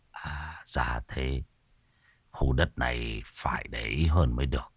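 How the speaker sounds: put-on low, hushed scheming old man; sly old sorcerer. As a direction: whisper the greedy idea to the boy. (thầy cúng già thì thầm tham lam)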